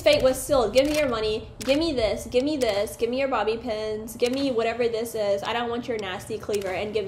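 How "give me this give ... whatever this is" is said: normal talking voice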